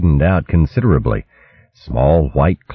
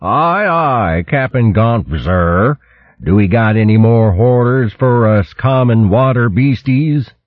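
mock deference; 6 semitones higher, slower than usual. A narrator's reading. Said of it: "threw a derisory salute".

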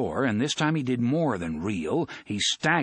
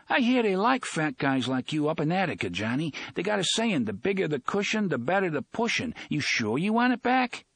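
taunting; 7 semitones higher than usual. Acted. Cop taunting Fat Johnny.

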